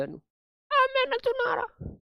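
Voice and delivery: put-on high, shy little-boy whine; shy child. Teacher voicing his shy child self who won't recite, high and bashful. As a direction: play the bashful kid refusing to recite. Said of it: "Kapil का शर्मा जावे".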